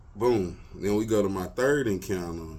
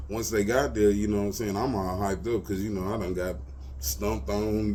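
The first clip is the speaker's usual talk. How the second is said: usual speaking voice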